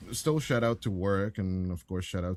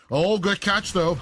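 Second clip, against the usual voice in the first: excited; 8 semitones higher, 8 dB louder, slower than usual. Real, not acted. Reacting to a play.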